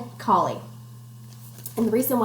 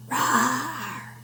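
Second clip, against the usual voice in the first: ordinary delivery, nothing marked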